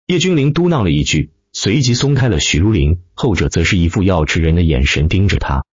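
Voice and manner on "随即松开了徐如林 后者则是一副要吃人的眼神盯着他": usual speaking voice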